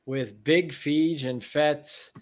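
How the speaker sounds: big, loud show opener; podcast intro announcer. Acted.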